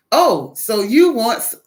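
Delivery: loud, eager misunderstanding; mock agent. Phone agent mishearing the caller, mock-bright and loud. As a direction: jump in as the mistaken phone agent.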